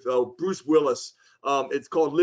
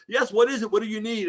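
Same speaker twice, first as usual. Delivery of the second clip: put-on mild French accent; 5 semitones higher than usual. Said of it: "I don't wanna try and imitate a French accent".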